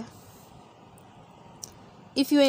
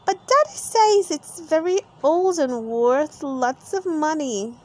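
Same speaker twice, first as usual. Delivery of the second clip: put-on higher little girl voice; lightly voiced little pig girl; Peppa voice; 5 semitones higher, 3 dB louder than usual. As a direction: Peppa protesting to Miss Rabbit, high girly voice.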